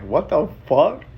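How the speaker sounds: slow, drawn-out rising question; retold astonished reaction; amused disbelief. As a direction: stretch it out, incredulous. Retold amazement at man's generosity.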